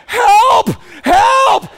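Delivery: very high, loud mock scream; screaming for help. Dad calling for nurses in an empty hall, screaming. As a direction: yell down the empty hallway. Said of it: "I start screaming".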